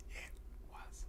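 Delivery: very slow, faint whisper; barely-there whisper. Real, not acted. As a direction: draw it out in a whisper. Repeats in drawn-out whisper.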